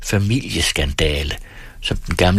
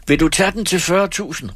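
much higher, demanding call; shouted challenge; 11 semitones higher than usual. A narrator's reading. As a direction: challenge the rival across the hall. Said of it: "råbte Erik Skintoft".

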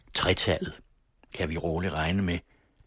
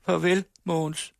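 much higher, plaintive call after him; 11 semitones higher, 4 dB louder than usual. Acted. Laura calls a mournful goodbye after departing Mogens. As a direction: call out mournfully as he walks away. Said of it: "råbte hun klagende".

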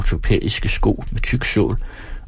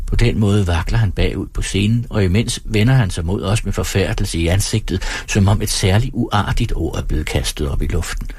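usual speaking voice

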